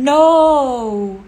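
ordinary talk, nothing marked

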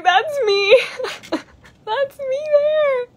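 very high, squeaky near-crying squeal; tearful excitement. Retold or imitated. Retelling seeing her game trailer, tearfully squealing. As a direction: re-enact being overwhelmed, voice shooting way up. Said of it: "I was about to cry".

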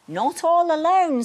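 high, loud snowman line smiling warmly; smiling, gentle. Acted. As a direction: say it with a kind smile. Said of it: "smiled Snowman".

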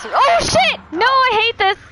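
high scared yelp; panicked shriek. Real, not acted.

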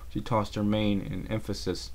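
ordinary delivery, nothing marked

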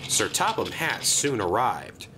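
ordinary delivery, nothing marked

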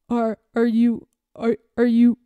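put-on disguised boy stammering in fear. A narrator's reading. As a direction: stumble over the words. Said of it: "he stammered hoarsely".

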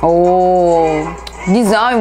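usual speaking voice

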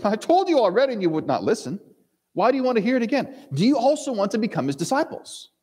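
high, teasing and fed up; exasperated retort. Acted. Healed man mocking the Pharisees, high and exasperated. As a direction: needle them with the last question.

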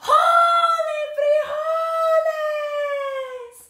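very high shout, stretched; battle cry. Acted. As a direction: thrust the sword and cry out. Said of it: "cried Skipito".

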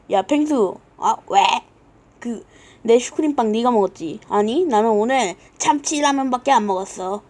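normal talking voice

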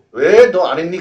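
loud mock scolding question. Shown as a demonstration. 틀린 해석을 흉내 내는 선생님의 꾸짖는 말투. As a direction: scold like an annoyed parent. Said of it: "그거 아니야".

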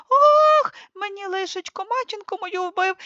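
high wailing lament over the dead; mock wailing. Son to the lord, wailing over the body. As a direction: wail as if the mother was killed. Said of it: "як наробить репету".

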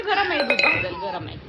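loud high alarmed call; loud alarmed warning; urgent warning. Real, not acted. Warns baby milk is hot.

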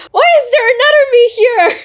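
put-on squeaky, rushed surprised girl; high panicky doll voice, quick; high fast Cherry voice. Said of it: "And the Cherry's like".

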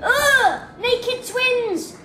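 disgusted. Real, not acted. Disgusted at naked figures.

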